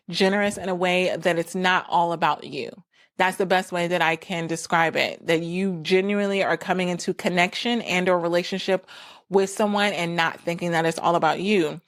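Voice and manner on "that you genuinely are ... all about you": normal talking voice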